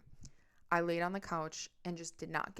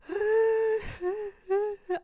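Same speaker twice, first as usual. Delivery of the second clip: high wailing sob burst; imitated sob; 7 dB louder than usual. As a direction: act out the uncontrollable sob. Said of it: "uncontrollably sobbing".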